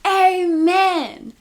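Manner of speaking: Bobby's big, high yelled amen; loud imitated outburst; mock yell. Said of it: "joins in and starts yelling".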